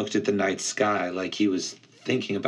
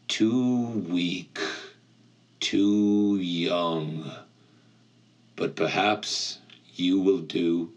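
ancient cold voice; 2 semitones lower, slower than usual. Acted. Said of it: "The voice felt ancient, cold, and heavy".

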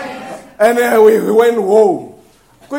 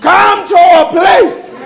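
high, urgent mock phone plea; pleading relatives; 7 semitones higher, 4 dB louder than usual. Relatives phoning the preacher about holidays, imagined high-pitched pleading. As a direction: call out imploringly, pitched well up. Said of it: "they should be phoning you and say".

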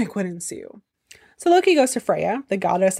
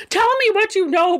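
yelling; 11 semitones higher than usual. Acted. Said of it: "and yells".

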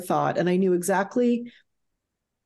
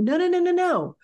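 half-joking insistence, voice pitched up; mock outraged protest; 8 semitones higher than usual. Grieving daughter tells the whole world to halt, mock outraged.

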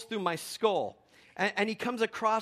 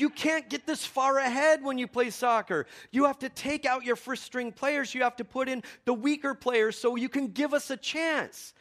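high, indignant mock scolding; imitating a coach's heated complaint; retold angry coach; 7 semitones higher, 4 dB louder than usual. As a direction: lay down the rule like an aggrieved rival coach. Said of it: "is angry".

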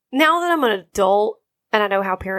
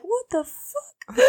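put-on much higher, slow, wide-eyed little kid voice; mock little kid; 11 semitones higher, 8 dB quieter, much slower than usual. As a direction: play a stunned small child, drawn out and high. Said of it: "little wide-eyed Austrian kid".

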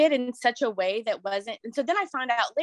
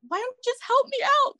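much higher, pleading voice; desperate plea; 13 semitones higher than usual. Retold or imitated. Upset mom begging another mom for help, high pleading. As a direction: beg her for help, voice climbing high.

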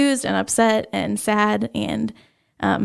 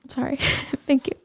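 soft, tearful apology and thanks; holding back tears; 3 semitones higher, 5 dB quieter than usual. Real, not acted. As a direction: apologize softly through real tears. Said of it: "trying really hard not to cry".

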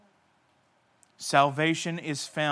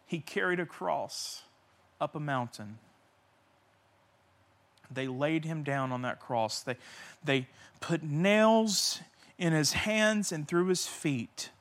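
ordinary talk

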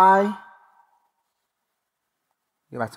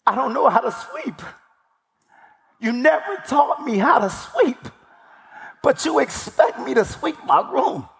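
higher-pitched child's plaint, retold; upset son's protest, pitched up; emotional young son; 5 semitones higher than usual. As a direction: imitate a hurt boy pleading with his dad. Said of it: "I still get emotional".

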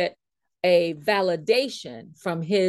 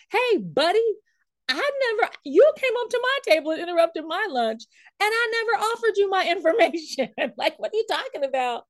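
higher-pitched imitation of hollering after someone; mock indignant holler; 10 semitones higher than usual. Speaker imagines hollering after the man who dismissed her, mock indignant holler. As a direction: play at calling out in protest, pitched well up. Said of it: "I wanted to holler after him".

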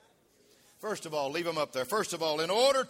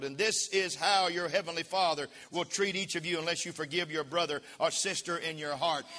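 ordinary talk

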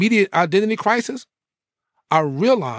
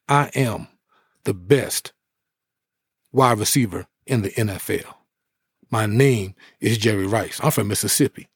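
imitating Jerry Rice; 7 semitones lower than usual. Jerry Rice introduces himself on the bus, in a deep confident voice. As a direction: lower the voice and own the room. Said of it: "he says".

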